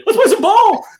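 loud, very high goofy holler; high-pitched comic shout, half laughing; Daniel Stern. Acted. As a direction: whoop it out like a manic movie character rattling the cage. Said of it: "rattled the cage behind there like Daniel Stern".

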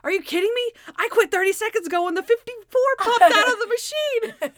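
high, loud, incredulous crowing; exultant outburst. Retold or imitated. Captain tells Monk he already quit, exultant shouting. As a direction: build to a gleeful shout, imitating the winner.

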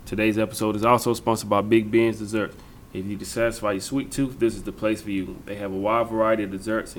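lively local radio ad read; sponsor ad read. Acted. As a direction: sell the sponsors like a host read. Host reads sponsor ads.

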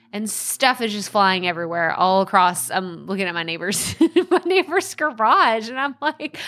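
ordinary talk

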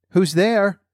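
put-on high-pitched old grandmother calling out; grandmother's voice. A narrator's reading. Grandmother calls to the knock at her door, high-pitched. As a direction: ask who's knocking in a high voice.

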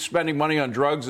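normal talking voice

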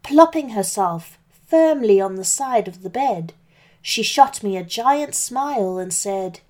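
ordinary delivery, nothing marked

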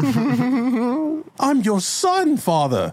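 very high, drawn-out plea; pleading son. Acted. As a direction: stretch it into a hammed-up wail. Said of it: "He tries to plead with Father".

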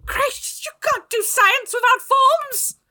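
be outraged as a witch; witchy voice. Acted.

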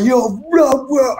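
high-pitched burst of pretend ranting; blustering imitation of a hothead; mock angry rant. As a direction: act out going mad at her without real anger. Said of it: "I would have gone mad at her".

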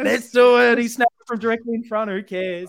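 high, loud hammed-up fan cheer. Fan backing Harry's snap at goal, excited cheering. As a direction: shout it like a supporter in the stands. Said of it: "if we just cheer and be like".

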